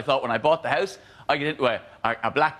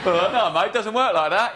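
higher, laughing put-on cabbie; mock cabbie refusal; 7 semitones higher than usual. Cabbie refusing a passenger, laughing mock voice. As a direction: refuse the fare with cocky cabbie scorn.

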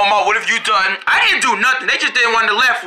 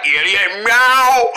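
slow, stretched silly meow; imitated funny voice; much slower than usual. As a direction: copy the comic's silly meow. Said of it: "You were like".

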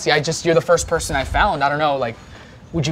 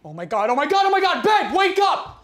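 high, shrieking wake-up call; excited shouting; 12 semitones higher than usual. Acted. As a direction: yell them awake in a frenzy. Excited spouse waking partner.

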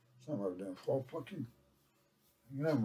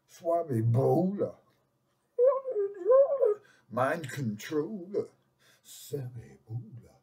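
slow announcing; 3 semitones higher, 5 dB louder, slower than usual. Pretend.

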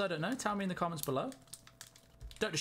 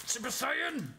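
much higher, fast, hammed-up alarm; high, fast mock dismay; mock panic; 11 semitones higher than usual. Real, not acted. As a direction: play up the disaster, pitched way up. Pack wrapper tears badly.

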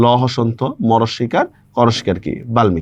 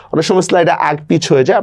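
ordinary delivery

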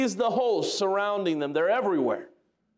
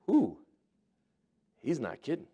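awed surprise; 4 semitones lower, 4 dB quieter than usual. Acted. Servant sees the heavenly army.

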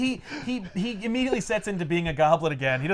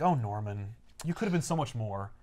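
low, soft mock mourning; mild regret; 6 semitones lower, 7 dB quieter than usual. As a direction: mourn him gently, lightly acted. Peter mourns dead Norman.

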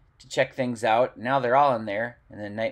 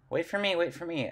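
higher, eager little hen; lightly voiced excited chicken; 6 semitones higher, 5 dB quieter than usual. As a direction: call out like an impatient hen. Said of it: "Sunflower's like".

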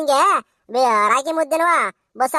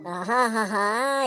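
contented relishing aha; 6 semitones lower, 5 dB quieter, much slower than usual. Acted. Wife relishing festival dumplings.

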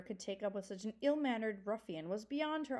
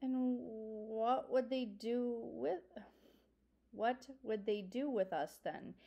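trembling voice; slower than usual. A narrator's reading. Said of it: "asked in a trembling voice".

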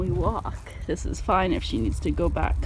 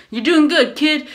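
bad Boston accent; 4 semitones higher, 7 dB louder than usual. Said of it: "in the Boston accent, which I can't do".

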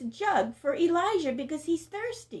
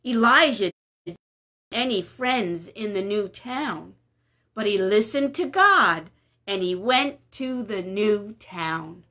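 put-on lively storybook narration for kids; story reading; 3 semitones lower than usual. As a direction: read it like a picture book to little ones. Reading a Bible story to children.

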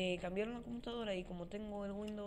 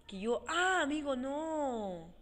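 a high, loud cry of dismay, stretching "amigo"; sudden dismay; 6 semitones higher, 9 dB louder, much slower than usual. Real, not acted. Gamer cries out to viewers on remembering a problem, loud and dismayed. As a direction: blurt it out as the realization hits.

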